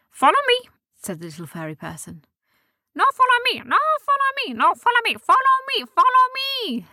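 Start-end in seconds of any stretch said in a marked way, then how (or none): 0.1-0.7 very high squeaky little voice; high squeaky fairy leading the way; fairy creature voice. Acted. Little fairy person speaks. 2.9-6.9 very high squeaky eager voices; high fairy heads all squeaking follow me; squabbling fairy voices. Acted. Other heads all compete.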